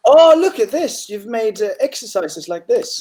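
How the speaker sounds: real pleased surprise at the student's work; delighted surprise. Real, not acted. Teacher discovering the student's own exercises, delighted surprise.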